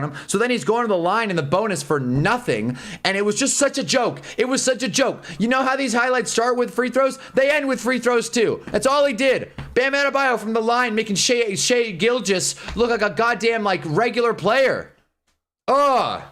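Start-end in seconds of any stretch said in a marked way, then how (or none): 3.0-14.9 mad rant, pitched higher. Real, not acted. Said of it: "Makes me so mad". 15.7-16.2 disgusted groan. Real, not acted. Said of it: "Makes me so mad".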